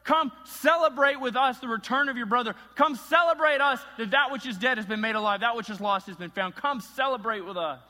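loud, high pleading father calling his son in; begging father. Retold or imitated. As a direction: plead with the son to come to the party. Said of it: "he is begging him".

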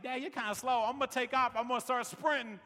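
a hint of a child's voice; imitating daughter. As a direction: pitch it up a little as your daughter. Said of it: "and then she's like".

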